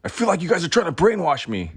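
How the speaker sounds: high, loud panicked yell; a loud, high, frantic scream; retold scream. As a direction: shriek it like you're cornered. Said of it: "when I screamed".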